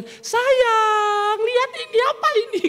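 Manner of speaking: very high, drawn-out gleeful call; acted squeal over a gift; excited spouse. Husband home from the office with a present, high excited cooing.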